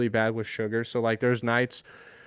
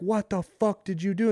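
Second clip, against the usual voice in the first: mock screaming accusation; 7 semitones higher than usual. Stranger in the hallway blaming a guest, mock scream. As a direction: imitate an angry stranger's scream without full volume. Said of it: "this guy's screaming at me".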